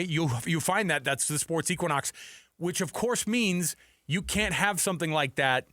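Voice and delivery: ordinary delivery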